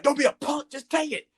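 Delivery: high, loud yelled order; mock yell. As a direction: bark it like the pushy friend. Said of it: "Sterling likes to yell at you".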